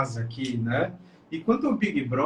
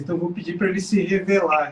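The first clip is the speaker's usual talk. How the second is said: usual speaking voice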